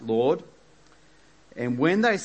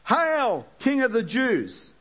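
high, slow sneering hail; mock him like jeering soldiers; 10 semitones higher, slower than usual. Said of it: "they mocked".

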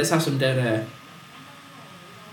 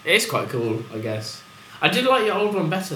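ordinary delivery

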